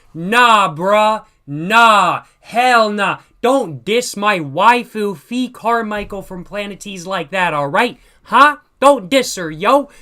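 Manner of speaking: loud, outraged fan defending waifu; indignant shouting. Real, not acted. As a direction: shout it like they insulted your waifu. Defending his anime waifu loudly.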